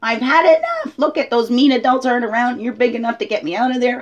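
high, loud cry to be let out; screaming inner child. Acted. Trapped inner child begging the grown-up self, screaming. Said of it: "This little being screaming".

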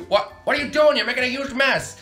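high, loud outraged shout; shocked yelling. Acted. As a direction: yell in disbelief at the mess. Finds kid making a mess.

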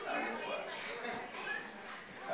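strained, breathy near-whisper, drawn out slowly. Real, not acted. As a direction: push it out through a sore throat. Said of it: "if I'm straining a bit".

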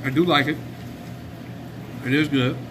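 talking while chewing; speaking around food; mouth full, chewing. Real, not acted. Seen 0: Bit burger at -9; jaw and lips keep chewing while speaking.

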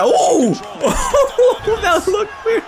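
high-pitched words through laughter; real amused laughing fit. Real, not acted. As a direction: crack up and squeeze the words out high. Laughing at an odd animation.

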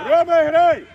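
loud touchline yell; shouted urging. Real, not acted. Coach urging Roma to play on, loud shout. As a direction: yell to get the player moving.

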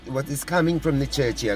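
usual speaking voice

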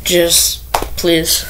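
ordinary delivery

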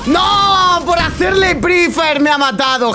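angry shout after dying; raging gamer. Real, not acted. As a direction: yell it out in frustration. Death in match, shouts.

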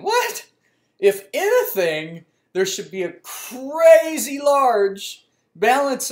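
high, loud, slow shock; the card just got declined; stunned cashier-line disbelief. Retold or imitated. Shocked thought at the register.

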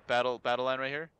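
ordinary talk, nothing marked

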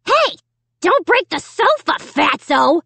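high-pitched, rasping puppet jeer; raspy dummy. A narrator's reading. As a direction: rasp the insult like a nasty dummy. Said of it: "he rasped".

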